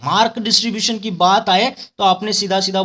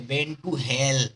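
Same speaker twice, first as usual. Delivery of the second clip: memory-phrase demo; 5 semitones lower, 6 dB quieter, much slower than usual. Shown as a demonstration. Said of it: "कहाँ जाओगे?".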